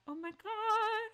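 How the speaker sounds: high, loud, slow dismay; gasping at the timer; real panicked drawn-out oh my god. Real, not acted. Streamer panics at a timed choice, slow and loud.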